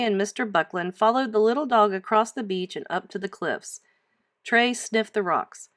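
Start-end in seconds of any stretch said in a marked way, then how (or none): none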